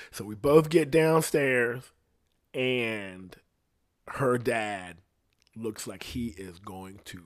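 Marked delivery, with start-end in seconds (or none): none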